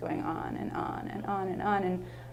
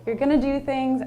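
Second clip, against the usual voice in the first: earnest encouragement; 7 semitones higher, 6 dB louder than usual. Retold or imitated. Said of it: "I was like".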